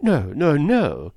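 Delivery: loud, high, frantic denial; wild cry. A narrator's reading. Woman refuses to hear the name, wildly. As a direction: cry out to stop him. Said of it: "cried Miss Howard wildly".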